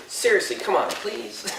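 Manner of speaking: high-pitched, laughing mock plea; mock pleading. Would-be cop to a suspect who won't get down, mock pleading. Imagines himself as a too-soft cop.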